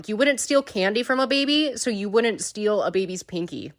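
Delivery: ordinary delivery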